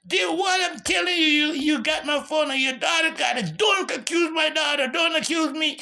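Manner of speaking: high, loud heated shouting; yelling. Retold or imitated. Man accused over his missing phone, yelling back. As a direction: argue at the top of your lungs. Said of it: "I'm yelling to the top of my lungs".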